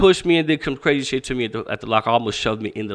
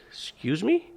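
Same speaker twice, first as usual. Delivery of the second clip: higher, softer puzzled question; taken aback; 7 semitones higher, 5 dB quieter, slower than usual. Retold or imitated. Bullied kid answering the gang leader, taken aback. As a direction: lift it into a startled query.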